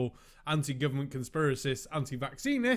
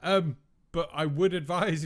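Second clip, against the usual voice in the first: ordinary delivery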